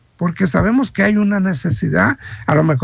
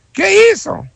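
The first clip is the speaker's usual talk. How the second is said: emphatic question; 11 semitones higher, 5 dB louder than usual. Real, not acted. Preacher asks rhetorically.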